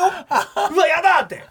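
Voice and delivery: startled woman. Retold or imitated. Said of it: "ドキッとしてる女性もいるよ".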